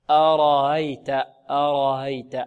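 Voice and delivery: أرأيت with the second hamza eased, between alif and ya. Shown as a demonstration. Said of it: "في رواية الإمام قالون نقول ماذا". (أرأيت بتسهيل الهمزة الثانية بين بين)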